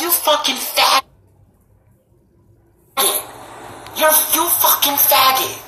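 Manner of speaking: imitated high-pitched angry insult; mad. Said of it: "He was so mad".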